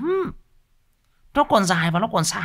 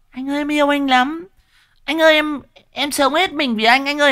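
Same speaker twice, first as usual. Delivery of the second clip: mock sweet girly voice, much higher; showy girlfriend; 11 semitones higher, 4 dB louder than usual. Mocking a girl who gushes love out loud, high and sugary. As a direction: gush it like an overacting girlfriend. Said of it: "những cái đứa con gái mà nó hay thể hiện ra ngoài".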